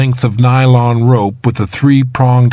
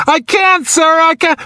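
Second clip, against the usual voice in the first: very high, despairing whine; desperate. A narrator's reading. Trapped man tells rescuer he can't do it, desperate. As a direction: give up in a high, anguished voice.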